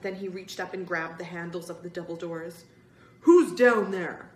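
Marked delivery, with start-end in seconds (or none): 3.2-4.3 loud, slow, angry demand; angrier shout. A narrator's reading. Spidey closing in on the children, slow loud angry demand. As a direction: shout it down, stretching the words.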